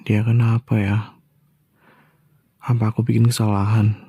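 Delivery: whispered aside to himself. Acted. Husband puzzling over his sulking wife, whispering. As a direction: breathe the question under your breath.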